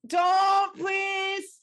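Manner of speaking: loud, high, pleading cry. Real, not acted. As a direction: beg them to stop, loudly. Friend dislocating joint, pleads.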